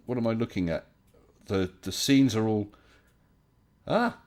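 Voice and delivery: ordinary delivery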